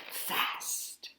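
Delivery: final word whispered; hushed ending word. Acted. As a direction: drop to a whisper on fast. Whispered punchline of the story.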